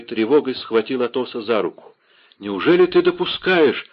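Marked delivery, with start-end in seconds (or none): 2.4-3.9 высокий отчаянный вопрос; с подступающими слезами, повысив голос; голос на грани рыдания. A narrator's reading. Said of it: "Голос его перешёл в рыдание". (отчаянно, почти рыдая)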